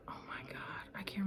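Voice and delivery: whispered to herself. Real, not acted. Trying to remember a place name, whispered to herself. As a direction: whisper while searching memory.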